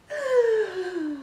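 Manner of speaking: put-on very high, loud squeal of a girl in a toy advert; girly advert voice. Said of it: "They talk in a really high voice".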